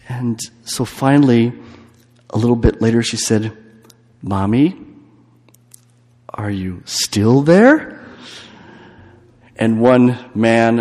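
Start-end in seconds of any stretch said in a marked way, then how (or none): 4.2-7.9 put-on a small girl drawing out her call in the dark; slow frightened call. Little girl on a dark train calling slowly to her mother. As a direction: stretch it out, scared and small.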